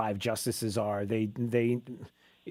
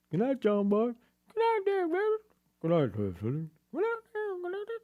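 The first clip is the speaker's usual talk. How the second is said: Waltons goodnights. Retold or imitated. Host acting out the Waltons' bedtime goodnights, mixed voices. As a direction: take each goodnight in a new family voice. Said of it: "I think it was like".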